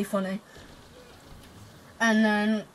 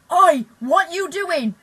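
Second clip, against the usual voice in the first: slow, loud, higher yell at the lads; retold yell; 8 semitones higher, 9 dB louder, much slower than usual. Mum confronting the boys threatening his mate, a slow loud yell. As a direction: bellow it across the park, drawn out. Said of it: "and she yells".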